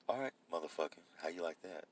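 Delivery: low smooth radio cussing. Shown as a demonstration. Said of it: "[name], you got a radio voice".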